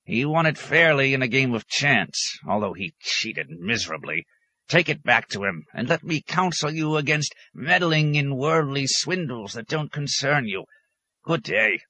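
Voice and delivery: high-pitched, dismissive counsel; crotchety hermit in a high voice; cranky old hermit. A narrator's reading. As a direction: lecture the visitor, then dismiss him.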